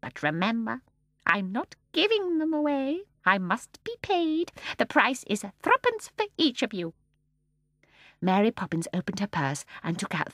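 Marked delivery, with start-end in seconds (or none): 0.0-7.0 put-on firm, high old woman; serious old lady. A narrator's reading. Mrs Corrie asking for payment, serious. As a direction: turn businesslike. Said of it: "her face grew serious".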